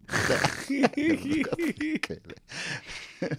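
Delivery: big burst of real laughter; long high laugh. Real, not acted. Punchline recalled, big laugh.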